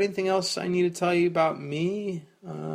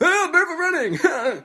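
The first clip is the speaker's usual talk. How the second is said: high, loud hammed-up shriek of alarm; mock freakout; 12 semitones higher, 8 dB louder than usual. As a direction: play an uptight Californian panicking at a barefoot runner. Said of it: "they're like".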